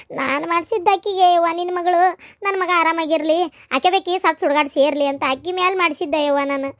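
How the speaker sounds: usual speaking voice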